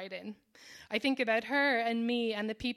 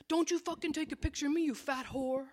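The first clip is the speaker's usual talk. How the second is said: higher, fast, imitating an angry stranger's snarl; angry yell; 5 semitones higher than usual. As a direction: spit it out like the furious guy on the street. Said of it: "he yelled at me".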